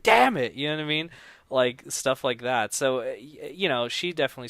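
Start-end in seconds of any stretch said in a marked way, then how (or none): 0.0-0.5 high, loud mock curse; retold frustrated outburst. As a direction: burst out as if exasperated. Retold outburst while working on script.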